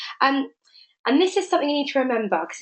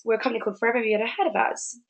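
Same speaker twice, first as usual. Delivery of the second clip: sales pitch voice; 4 dB quieter, faster than usual. Retold or imitated. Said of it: "Andy Waring's response".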